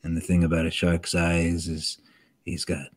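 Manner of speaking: low, soft shark-hunter monologue from Jaws; Quint. Acted. Showing clients his dragon's voice, low shark-hunter impression. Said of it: "he kinda talks like this".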